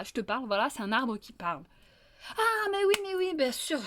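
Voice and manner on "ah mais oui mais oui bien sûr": a high, bright yelp of remembering; as if it finally clicks; sudden realization. Acted. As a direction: fox remembering sheepishly.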